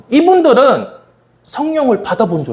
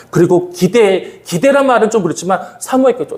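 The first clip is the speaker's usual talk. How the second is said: ordinary talk, nothing marked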